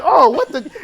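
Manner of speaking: high, loud, shocked and laughing; startled laughing. Retold or imitated. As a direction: react in shock through a laugh. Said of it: "I'm like".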